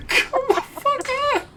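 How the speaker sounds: extremely high, strained frustrated plea; despairing whine. Real, not acted. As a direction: squeal in despair at the ball. Frustrated at missed putt.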